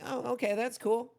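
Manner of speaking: high imitated child gamer; kid voice. Kid player giving up the loot, kid voice. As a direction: answer in a put-on high kid voice. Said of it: "with that kid voice".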